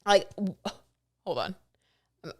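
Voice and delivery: halting, tripping over words; stumbling over words. Real, not acted. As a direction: get tongue-tied mid-sentence. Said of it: "I'm choking on my words here".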